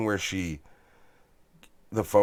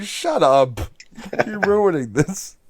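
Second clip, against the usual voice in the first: imitating wife; 8 semitones higher, 4 dB louder, slower than usual. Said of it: "my wife is like".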